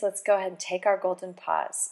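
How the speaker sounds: ordinary talk, nothing marked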